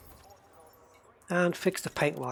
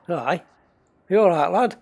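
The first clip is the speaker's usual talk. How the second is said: louder, playful Yorkshire greeting with a broad Aye; Yorkshire accent; 3 semitones higher, 8 dB louder than usual. Pretend. Pilot greets a lad in a louder Yorkshire accent. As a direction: put on a hearty Yorkshire accent, calling out to a lad. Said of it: "Aye, Yorkshire accent".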